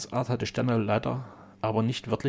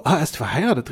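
louder, higher cry of discovery; startled exclamation; 4 semitones higher, 5 dB louder, faster than usual. A narrator's reading. Duke leaps from his chair at the news, exclaiming. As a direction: exclaim it as if jumping up. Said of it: "rief der Herzog, indem er vom Stuhle emporsprang".

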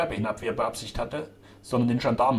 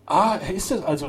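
higher, louder exclamation; calling out; 7 semitones higher, 7 dB louder than usual. A narrator's reading. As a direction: exclaim it in sudden recognition. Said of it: "rief der Bürgermeister".

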